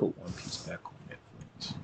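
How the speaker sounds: whispering. Real, not acted. Quiet aside.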